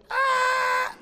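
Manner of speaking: put-on a sudden high child's wail, loud; mock child wail. Pastor imitating his son's real cry for the congregation. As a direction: imitate a kid's scream after sucking in air.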